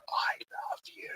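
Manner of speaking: slow, unvoiced whisper. Host teasing a viewer to read lips, slow whisper. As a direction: mouth it with barely any breath behind it. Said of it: "I think I whispered".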